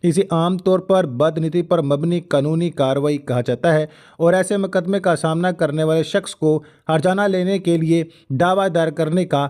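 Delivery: normal talking voice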